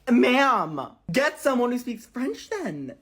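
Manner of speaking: loud, high, indignant order; acted customer outrage; exasperated demand. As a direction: raise pitch and volume, fed up with the agent. Retold demand to the luggage agent.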